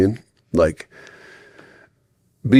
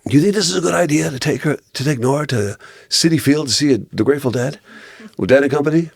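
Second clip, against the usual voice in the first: skeptical wife; 7 semitones higher, faster than usual. Retold or imitated. Wife questioning taking daughter to show.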